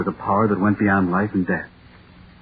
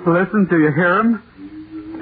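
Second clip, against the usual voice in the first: very slow, drawn out, higher; slow fearful hush; 6 semitones higher, much slower than usual. Acted. To Mister Gang about the thing, slow and fearful. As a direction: stretch each word as if afraid to be heard. Hearing the creature nearby.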